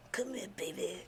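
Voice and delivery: soft, coaxing grandma voice; tender grandma. Retold or imitated. Grandma offering a hug, softly tender. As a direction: imitate a grandma calling a child close. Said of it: "how y'all grandma hug y'all".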